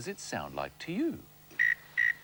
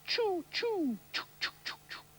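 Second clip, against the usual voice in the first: high choo fading to breathy; train sound. Retold or imitated. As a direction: puff it like a train.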